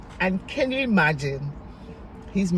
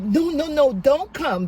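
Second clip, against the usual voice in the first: high, louder string of refusals; urgent retold protest; 9 semitones higher, 5 dB louder than usual. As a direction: act out hurriedly talking someone out of it. Retold call, waving off his offer.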